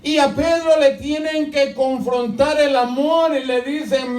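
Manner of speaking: fervent high sermon shout, slow; full-force preaching. Acted. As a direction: drive God's words home at full voice.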